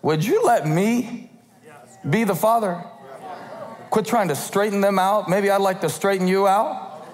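be a father about to come downstairs; mock stern heavenly parent; God as fed-up parent. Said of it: "God looks down ... and said".

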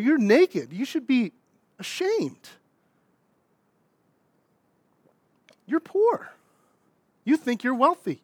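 high-pitched, pointed pulpit reproach; preaching rebuke. Acted. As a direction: drive each accusation home to the congregation. Preaches rebuke in Jesus' words.

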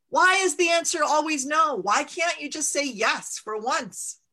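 fed-up mother yelling her frustration; furious mother shaking her fist at the mountain; angry outburst. Acted. Ma glares, stamps her foot.

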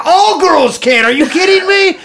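high, stretched, incredulous cry; mock outburst. Real, not acted. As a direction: exclaim it high with a laugh breaking in. Mock outburst in teasing banter.

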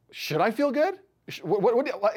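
high-pitched panicky question, then stammering; acted alarm. Patient questions the technician mid-test, alarmed and stammering. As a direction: leap up high in mock alarm.

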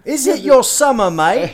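a jokey fake Aussie asking about summer; bad Australian accent. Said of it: "That's a horrible accent".

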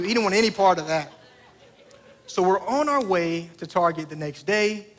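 normal talking voice

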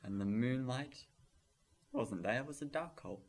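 hushed spooky narration; spooky storytelling. Acted. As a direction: tell it like a ghost story round a campfire.

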